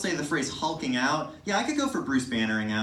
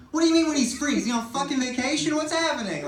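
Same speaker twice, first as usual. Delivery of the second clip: higher, louder indignant rant; outraged; 11 semitones higher, 4 dB louder than usual. Retold or imitated. Comedian ranting at the nurse about the absent cardiologist.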